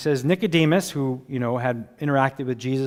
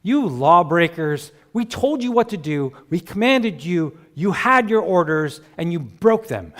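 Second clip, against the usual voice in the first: scolding rant; 4 semitones higher, 5 dB louder than usual. Acted. Pharisees berating their officers for breaking orders, loud scolding. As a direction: berate them like disobedient servants.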